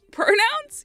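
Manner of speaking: PRONOUNS swooping up high at the end; incredulous question. Retold or imitated. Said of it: "question mark".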